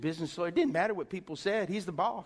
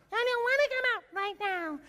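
high, feminine little demon voice; English imp voice; 4 dB louder than usual. Retold or imitated. Demon refusing to leave, in a high English imp voice. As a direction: whine like a small English imp. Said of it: "Little English kinda imp, feminine kinda".

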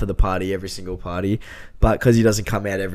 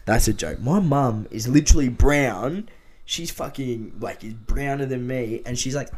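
usual speaking voice

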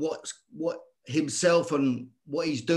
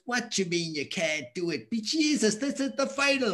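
incredulous Irish father, pitched up; Irish dad impression; 6 semitones higher than usual. Dad answers his scared son before the final, Irish accent. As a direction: imitate a scolding Irish dad. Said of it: "And my dad's Irish".

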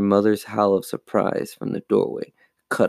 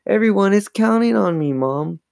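high, pleading appeal; protesting plea; 11 semitones higher than usual. A narrator's reading. Chris begs Mom to relent, protesting plea. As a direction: plead high, easing down at the end. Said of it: "Chris protested".

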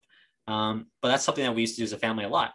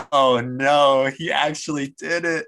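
higher, louder gasp of alarm; mock alarm; 5 semitones higher, 7 dB louder, slower than usual. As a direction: retell your own shocked reaction, pitched up. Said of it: "I'm like".

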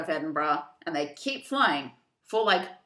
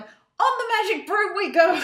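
gleeful; 13 semitones higher, 6 dB louder than usual. Retold or imitated. Essie suddenly game.

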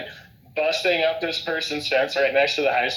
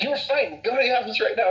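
high scolding; 7 semitones higher, faster than usual. Retold or imitated. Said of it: "She's like".

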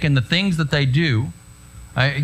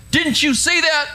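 irate cop; 12 semitones higher than usual. Retold or imitated. Pastor voicing the angry officer at his window. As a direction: bark at the driver like a mad cop.

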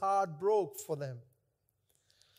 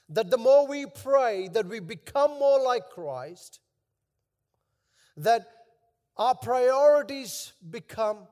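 preaching; 3 semitones higher, 5 dB louder than usual. Acted. Pastor urging the congregation, preaching with rising force. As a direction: drive the point home with rising force.

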